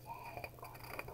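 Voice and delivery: unvoiced tiny yay, very soft; hushed excited whisper; whispered cheer. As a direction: whisper the cheer like a secret treat.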